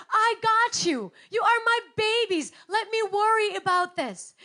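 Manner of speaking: put-on pleading with her kids to stop worrying; loving parent. Mother reassuring her worried children, loud and insistent.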